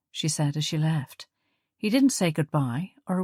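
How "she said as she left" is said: usual speaking voice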